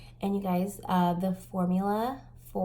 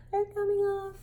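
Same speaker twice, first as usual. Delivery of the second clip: high, laughing wail over falling lashes; laugh-cry it out; 13 semitones higher, 5 dB louder than usual. Real, not acted. To viewers as her lashes fall off, laughing wail.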